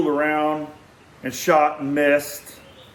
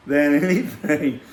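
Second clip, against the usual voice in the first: plain reading voice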